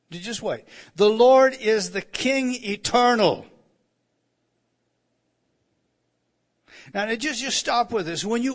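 loud, high, impassioned preaching; loud fervent preacher driving the point home; preaching at full force. Acted. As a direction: build the sermon to a ringing climax. Preaching climax on false gods.

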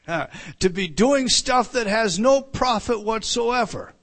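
loud fervent pulpit preaching; preaching at full force. Acted. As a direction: preach it hard to the congregation.

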